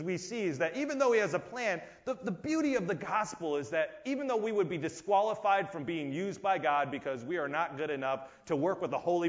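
usual speaking voice

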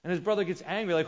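ordinary delivery

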